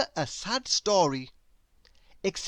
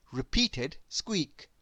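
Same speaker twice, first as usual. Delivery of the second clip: ordinary delivery, nothing marked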